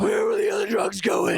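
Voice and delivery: high, gravelly Batman growl impression. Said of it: "yours is way better".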